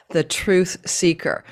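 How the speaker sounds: slow, weighty stress on "truth seeker" with a falling tune; emphasis on truth seeker. Shown as a demonstration. Astrologer stressing a star's meaning to viewers, slow emphasis. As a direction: lean hard on "truth seeker" and let it land slowly. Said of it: "emphasize the word truth seeker".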